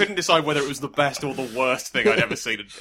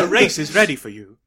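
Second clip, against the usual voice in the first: louder put-on game narrator voice; Brian Cox; 3 semitones higher, 7 dB louder than usual. Game narrator calls the player to race, louder put-on voice. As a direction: announce it like a game's narrator. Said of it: "that was my impression of Brian Cox".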